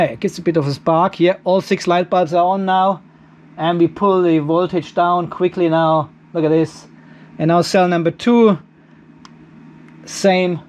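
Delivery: ordinary delivery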